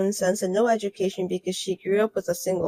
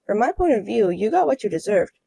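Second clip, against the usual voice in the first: ordinary talk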